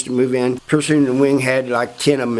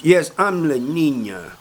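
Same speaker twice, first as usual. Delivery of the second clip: slow, drawn-out answer in character; proud confirmation; slower than usual. Retold or imitated. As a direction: confirm your nickname proudly, stretching the words. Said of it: "He goes".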